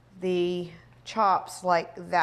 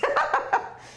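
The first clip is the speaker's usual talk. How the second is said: high laugh. Real, not acted. After toasting cheers.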